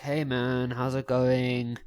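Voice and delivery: drawn-out, sluggish greeting; low energy. Acted. Tired guy walking up to a girl, low-energy greeting. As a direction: greet like you're running on empty. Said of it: "running on about three percent energy".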